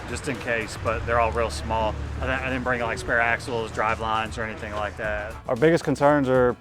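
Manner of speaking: usual speaking voice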